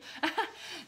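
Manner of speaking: a brief soft laugh at herself; short laugh. Real, not acted. Reader laughs at her own odd word choice, soft laugh. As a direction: laugh lightly, a little embarrassed.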